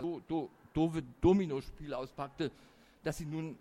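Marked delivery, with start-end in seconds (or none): 0.0-1.0 playful stammer on the first sound; stuttering. A narrator's reading. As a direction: stumble over the opening syllable. Stammer carried into the narration.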